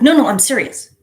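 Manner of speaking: insisting husband. Retold or imitated.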